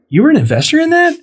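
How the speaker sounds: quick, high-pitched disbelief; retold incredulous question. Learning his friend invested, high and incredulous. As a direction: jump up high as if stunned.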